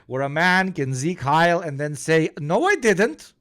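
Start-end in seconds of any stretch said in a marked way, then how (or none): 2.4-3.3 put-on high, childish-sounding rising protest; mock denial. Man denying his salute to a reporter, high mock denial. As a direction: deny it flatly on a high rising note.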